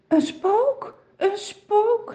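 high frightened cry of ghost; screaming in fear. A narrator's reading. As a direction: disciples cry out a ghost in panic, high and scared. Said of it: "gillen ze".

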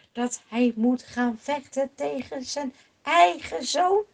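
ordinary delivery, nothing marked

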